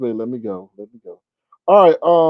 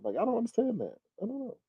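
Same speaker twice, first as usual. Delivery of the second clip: higher and much softer, bewildered; baffled; 8 semitones higher, 11 dB quieter, faster than usual. Real, not acted. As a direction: trail off in disbelief. Baffled at a commenter's logic.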